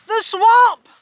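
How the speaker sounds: loud, high cry of alarm; terrified cry. A narrator's reading. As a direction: shriek the name in panic. Kids panic at the witch.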